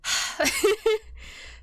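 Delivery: long frustrated sigh; heavy sigh. Real, not acted. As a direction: exhale in frustration. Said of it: "sighs and is upset at myself".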